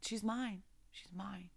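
ordinary talk

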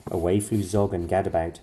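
usual speaking voice

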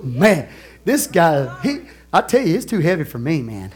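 normal talking voice

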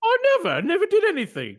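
high-pitched British protest; hammed-up British shopkeeper's denial; British accent. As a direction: squeal innocence in a put-on British accent. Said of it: "We're gonna try our British accents".

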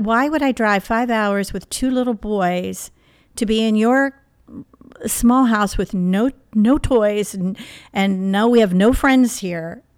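retelling her own upset protest, loud and drawn out; upset outburst. Retold or imitated. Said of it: "So I was very upset".

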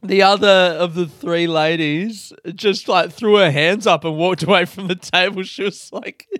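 high, loud retelling on the edge of cracking up; laughing storytelling. Real, not acted. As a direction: keep telling it through real laughter. Still laughing while telling reaction.

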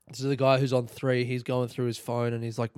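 normal talking voice

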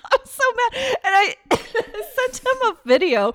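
high, slow words through laughter; laughing. Real, not acted. Co-host admitting her car mistake to listeners, laughing through words. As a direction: talk while cracking up.

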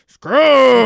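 loud, strained croak of a single word; stroke-victim rasp. Retold or imitated. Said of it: "where we went".

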